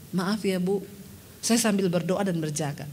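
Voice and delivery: low, soft, careful reply; gentle apology. Retold or imitated. Pastor answering the angry woman, low and soft. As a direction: answer softly so as not to provoke.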